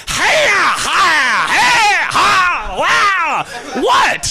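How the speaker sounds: high, loud karate-style cries; mock fighting yells. Cornered man pretends to lash out at people, mock fighting yells. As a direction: yell out attack cries in a hammed-up fight. Acts out attacking those who cornered him.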